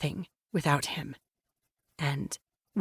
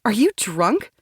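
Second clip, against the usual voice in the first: incredulous; 2 semitones higher, 5 dB louder than usual. Acted. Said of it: "My voice is incredulous".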